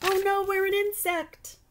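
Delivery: high dismayed cry; child realizing they became a bug; shocked. Acted. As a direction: react in alarm. Kids turned into ladybug.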